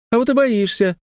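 put-on very high sing-out child voice; taunting little girl. A narrator's reading. Sister mocks brother's fear of heights, high taunting voice. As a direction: tease like a little sister, pitched right up. Детская перепалка, сестра дразнит.

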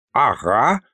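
ordinary delivery

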